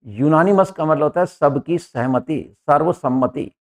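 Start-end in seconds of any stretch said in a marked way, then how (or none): none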